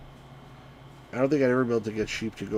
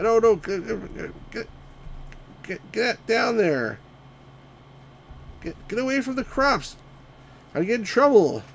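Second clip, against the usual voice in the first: high, urgent shouts at the sheep; much higher, louder pleading; frantic yelling; 11 semitones higher, 3 dB louder than usual. Real, not acted. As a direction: panic as they stray toward the crops.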